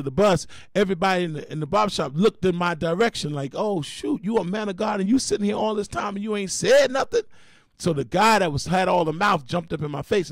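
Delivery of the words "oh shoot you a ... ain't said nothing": high, incredulous call-out; shocked crowd. Retold or imitated. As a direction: act out the whole shop gasping at you. Barbershop crowd reacting in shock.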